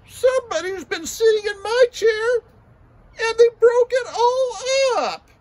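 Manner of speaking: put-on very high, loud, wailing baby bear; tiny crying baby bear. Baby bear crying to his parents, high and wailing. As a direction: wail it like a heartbroken little cub. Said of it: "Baby Bear said".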